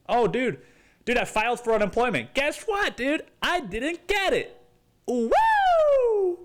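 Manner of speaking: high, loud, sarcastic cheer; fake-happy whoop over getting nothing; mock celebration. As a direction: cheer the bad news like a party. Mock celebration of bad news.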